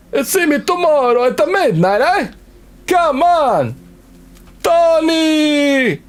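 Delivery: high, heated wiseguy rant; mock Italian-American. Mobster shopkeeper ordering Katie to his shop, heated. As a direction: play the pushy mobster shopkeeper.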